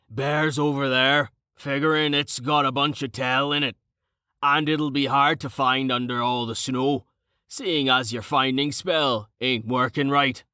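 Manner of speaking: hearty character voice with folksy diction, matter-of-fact; boisterous, rough-spoken Carten. Acted. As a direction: play a rough fighter explaining his reasoning.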